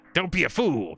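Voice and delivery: lightly voiced dismissive cartoon villain; Skeletor. Fan recalling how a cartoon villain brushed people off, lightly mocking. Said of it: "Skeletor was like a".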